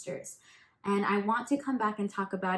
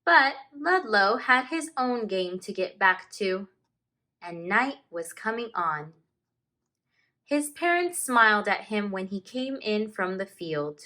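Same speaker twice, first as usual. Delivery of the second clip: slow, expressive read-aloud with long page-turn pauses; story narration; 7 dB louder, slower than usual. A narrator's reading. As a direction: read it like a teacher sharing a picture book with the class. Teacher reads picture book aloud.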